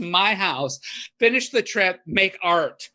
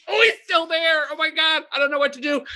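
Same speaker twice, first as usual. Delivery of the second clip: panicked; 10 semitones higher than usual. Retold or imitated. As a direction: shriek it like you're terrified. Panicking at man in window.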